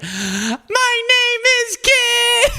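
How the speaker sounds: high, drawn-out singer's holler. Acted. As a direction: stretch each word way up high. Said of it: "[name] is like".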